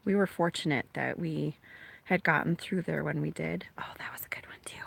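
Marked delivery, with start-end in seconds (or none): 3.8-4.8 breathless hushed whisper at the lightning; hushed storm-watching whisper; awed whisper. Real, not acted. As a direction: whisper it in awe, barely voiced.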